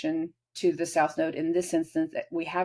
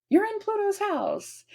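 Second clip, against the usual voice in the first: high dramatic voice; 14 semitones higher than usual. Acted. Voicing ominous Pluto setting.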